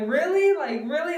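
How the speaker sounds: high incredulous double question; mock astonishment, starting high and loud; surprised. Aunt reacts to pregnancy news.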